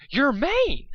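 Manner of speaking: horrified screech. Acted.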